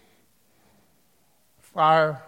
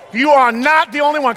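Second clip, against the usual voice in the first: preaching shout; 11 semitones higher, 14 dB louder than usual. Acted. Preacher repeats it louder.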